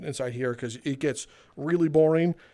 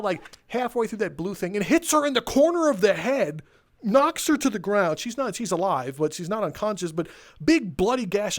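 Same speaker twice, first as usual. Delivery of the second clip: ordinary talk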